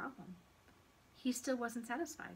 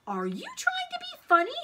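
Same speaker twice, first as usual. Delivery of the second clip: very high, loud incredulous scolding; outraged high-pitched question; indignant teacher; 9 dB louder than usual. A narrator's reading. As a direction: challenge a cheeky pupil.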